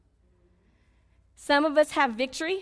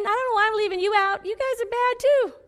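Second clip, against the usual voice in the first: high, raised mock accusation; teasing scold; 10 semitones higher, 7 dB louder than usual. Real, not acted. Preacher not leaving the men out, teasing scold. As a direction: playfully rail at the crowd.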